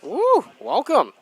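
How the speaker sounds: loud high cheer; excited whoop. Real, not acted. Greeting listener from Mexico.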